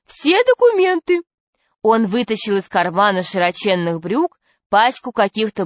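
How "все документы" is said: high-pitched fat man insisting; thin high voice. A narrator's reading. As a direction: protest in a piping voice. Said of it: "сказал толстяк тонким голосом".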